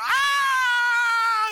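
put-on very high, loud, sustained kid's shriek; full-out imitated tantrum wail; tantrum scream. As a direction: scream like a child losing it in a store. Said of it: "screaming. They're throwing a temper tantrum".

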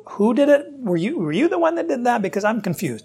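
a wrongly confused God asking who did it; mock confusion. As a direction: play it puzzled and lost, mock confusion. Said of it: "God is not confused".

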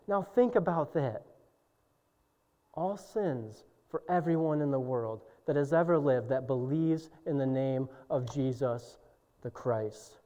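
usual speaking voice

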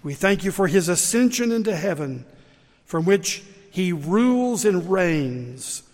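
loud, building prayer of thanks; fervent prayer. Real, not acted. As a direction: pray out with rising fervour. Leads the closing prayer.

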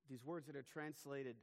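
ordinary delivery

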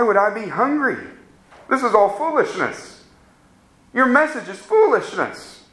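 a hint of a proud hypocrite brushing off the preacher; smug hypocrite. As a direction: scoff at the offer like someone who thinks he is already full. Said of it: "in his own self-righteousness says".

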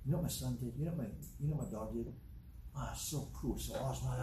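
softly acted brag to a neighbor; lightly voiced parent showing off, hushed; proud parent bragging. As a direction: toss off a quiet, proud boast. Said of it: "You tell your neighbor".

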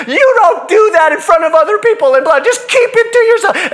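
put-on the lady firing back at the kid; scolding yell. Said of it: "she stands up and she says".

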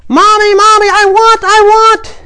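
piercing, very high tantrum screech; loud child's yell. Acted. Child yelling to Mommy at the store entrance, loud high screech. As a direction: shriek it like a demanding small child. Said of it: "the child began to yell loudly".

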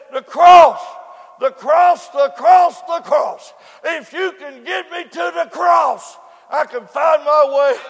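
loud, joyful cries of "the cross"; a lost child suddenly recognising home; happy excitement. Retold or imitated. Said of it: "She had a big smile. She clapped her hands".

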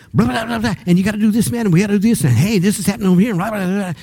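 high, fast, breathless chatter; hyper young man. Retold or imitated. Pastor mimicking the pumped-up visitor in his office, high and rapid. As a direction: rattle it off like he can't sit still. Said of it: "practically bouncing off the walls".

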